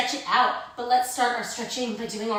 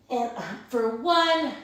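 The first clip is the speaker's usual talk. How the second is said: ordinary talk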